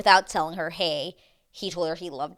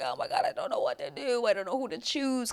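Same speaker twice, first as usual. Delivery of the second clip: higher-pitched imitation of a tearful woman; mock crying; 5 semitones higher than usual. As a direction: whine it like a confused crier, lightly mocking. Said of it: "crying on the floor and being like".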